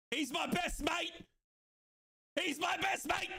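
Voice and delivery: high, proud fan's cry; elated boasting. Real, not acted. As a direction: call it out high, stretching it. Celebrating favourite player.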